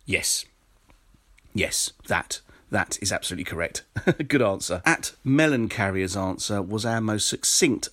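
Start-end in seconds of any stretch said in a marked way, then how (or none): none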